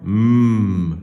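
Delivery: loud, sustained hummed "M"; isolated sound. Shown as a demonstration. Teacher giving pupils the last sound, hummed "M". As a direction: hum "M" alone, without a vowel. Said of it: "c'est le son".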